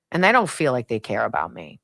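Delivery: usual speaking voice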